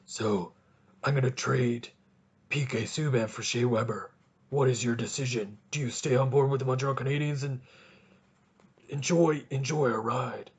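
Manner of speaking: low, menacing horror-villain voice; Jigsaw voice. Pretend.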